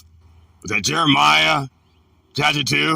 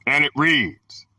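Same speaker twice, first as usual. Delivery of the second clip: ordinary talk, nothing marked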